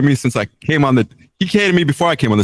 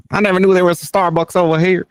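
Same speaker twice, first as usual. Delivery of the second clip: bad Southern accent; 7 semitones higher than usual. Guest mimics a comic amazed at Starbucks, bad Southern accent. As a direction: do a poor Southern-accent impression, pitched up. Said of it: "that was just my Southern accent".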